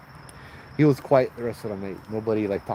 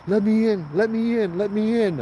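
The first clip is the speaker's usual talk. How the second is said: high pleading let me in; retold pleading; 10 semitones higher than usual. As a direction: beg to be let in, high and pushing. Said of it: "Brother is knocking on the window".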